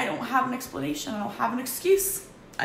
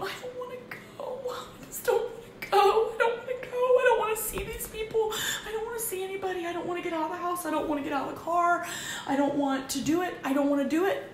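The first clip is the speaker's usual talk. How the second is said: put-on much higher, childlike whine, rushing the middle lines; whiny inner voice; 11 semitones higher, faster than usual. Her inner self dreading the gym, whining high and childish. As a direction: whimper like a kid refusing to leave. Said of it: "in my mind going".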